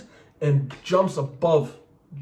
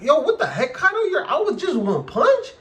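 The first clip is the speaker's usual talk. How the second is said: high, laughing disbelief, much higher; mock shocked laugh; 12 semitones higher than usual. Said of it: "she's just like".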